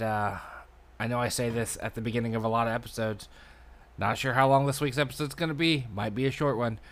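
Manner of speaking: ordinary delivery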